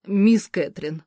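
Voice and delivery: ordinary delivery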